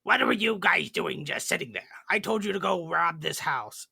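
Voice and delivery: a hint of a bossy chief chewing out his crew; goblin chief. Goblin chief scolding idle goblins, lightly voiced and mildly annoyed. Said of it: "had the goblin chief come over and go".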